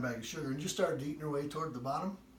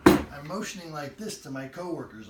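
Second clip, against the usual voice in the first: ordinary talk, nothing marked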